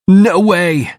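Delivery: high, loud, scared refusal; panicked refusal. Acted. David refuses Cassie in a high scared voice.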